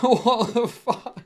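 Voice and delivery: ordinary talk, nothing marked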